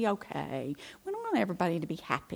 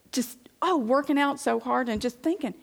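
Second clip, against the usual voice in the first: ordinary talk, nothing marked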